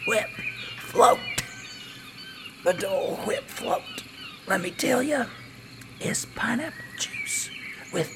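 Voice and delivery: dying whisper. Acted.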